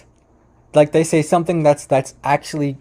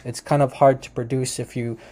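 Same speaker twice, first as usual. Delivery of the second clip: ordinary talk, nothing marked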